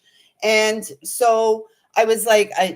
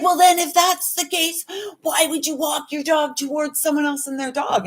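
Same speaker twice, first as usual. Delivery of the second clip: higher, whiny mock of the man; mocking the dog owner; 6 semitones higher than usual. Mocking the man who yelled about dogs, in a higher whiny voice. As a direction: imitate him as a prissy complainer. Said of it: "And he's like".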